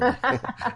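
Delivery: high cackling villain laugh; evil scientist laugh. Retold or imitated. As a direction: cackle like a cartoon mad scientist. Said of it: "put the little evil scientist laugh in there".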